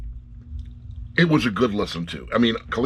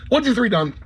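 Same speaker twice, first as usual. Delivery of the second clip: sudden high, loud 'done'; mock rush to the finish; mock quick finish; 14 semitones higher, 4 dB louder than usual. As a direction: snap it shut like a hurried ending. Quick rushed ending.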